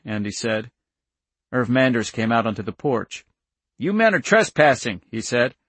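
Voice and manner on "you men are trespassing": high, indignant challenge. A narrator's reading. Farmer confronts agents on his porch, indignant. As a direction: confront them with raised pitch.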